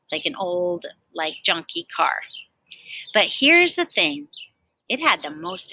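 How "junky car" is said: ordinary delivery, nothing marked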